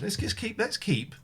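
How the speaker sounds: normal talking voice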